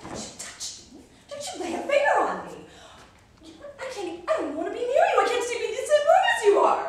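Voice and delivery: high, loud, half-laughing protest; hysterical refusal. Acted. Wife recoiling from her husband's touch, high and loud. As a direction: push him off, voice up in the rafters.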